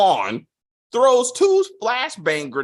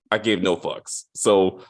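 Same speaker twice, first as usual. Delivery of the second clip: ordinary delivery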